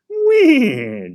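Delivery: a shrieking neigh, full horse; horse whinny. A narrator's reading. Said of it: "Joey repeated".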